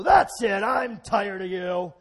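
loud, high hammed-up rage; mock angry God. Preacher imitating an angry God losing his temper, shouting. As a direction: rant like a fed-up, furious God. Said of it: "he doesn't go".

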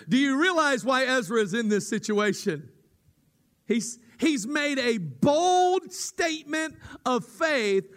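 fervent preaching, pushing every phrase; preaching at full force. Acted. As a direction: drive the point home to the pews. Preaching climax to congregation.

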